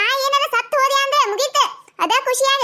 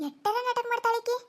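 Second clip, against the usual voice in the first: muttered aside; 7 dB quieter than usual. Acted. Aside about her drama.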